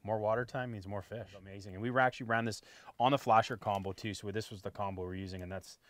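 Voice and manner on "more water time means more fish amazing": ordinary talk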